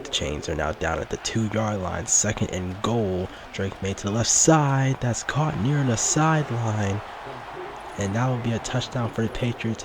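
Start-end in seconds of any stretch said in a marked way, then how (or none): 3.5-9.8 excited touchdown call. Real, not acted. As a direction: sell the touchdown like a broadcaster. Touchdown pass called live.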